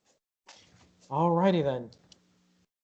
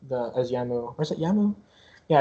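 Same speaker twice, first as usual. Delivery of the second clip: ordinary talk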